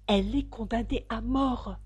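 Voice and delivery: ordinary delivery, nothing marked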